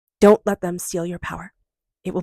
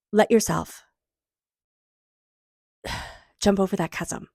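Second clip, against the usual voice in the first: ordinary talk, nothing marked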